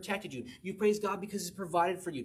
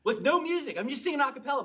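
excited; 9 semitones higher, 3 dB louder, faster than usual. Real, not acted. Excited retelling of singing.

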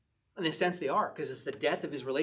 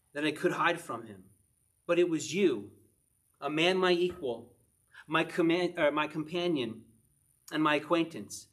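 louder, pleading psalm read; a preacher voicing the psalmist's plea, louder; scripture reading; 5 dB louder than usual. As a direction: call on the Lord like the psalmist.

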